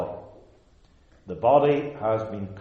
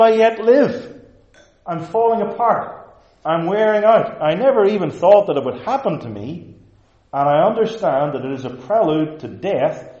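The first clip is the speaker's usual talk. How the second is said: louder and higher, pleading in anguish; despairing outcry; 3 semitones higher, 5 dB louder than usual. Retold or imitated. Aging listener crying out to the pastor, anguished and loud. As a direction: cry out to the pastor as if falling apart. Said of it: "you think, Boy".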